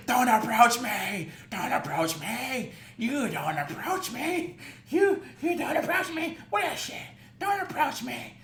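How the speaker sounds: high, drawn-out hammed-up hollering; mock yelling. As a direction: mimic her frantic repeated yelling, exaggerated. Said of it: "you are still yelling".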